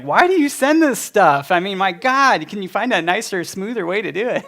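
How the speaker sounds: high, loud mock scolding wife voice; exasperated wife. Wife scolding his blunt message.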